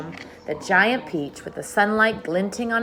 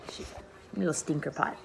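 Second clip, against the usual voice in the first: whisper; 3 semitones lower, 11 dB quieter than usual. Real, not acted. Aside about the dog.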